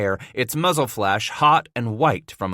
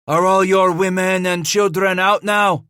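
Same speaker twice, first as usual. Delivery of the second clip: high, loud call across a distance; hollering; 8 semitones higher, 6 dB louder than usual. A narrator's reading. Henri questions the besieged family, hollering. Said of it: "Henri hollered".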